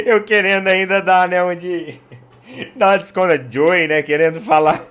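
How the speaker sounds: talking through laughter; real laugh breaking into the words; laughing while retelling his broken Spanish. Real, not acted. Laughing at his own embarrassment.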